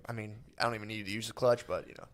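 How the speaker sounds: low, soft, offhand brag trailing away; bragging dad. Retold or imitated. Dad bragging about shifting without clutch, soft offhand murmur. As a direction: shrug off the skill, low-key boast. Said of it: "He's like".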